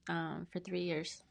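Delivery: ordinary talk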